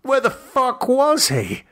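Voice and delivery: a kidnapped naked man's thoughts, rising terror; escalating terror. Acted. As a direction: build toward a near shriek. Captive's mounting terror.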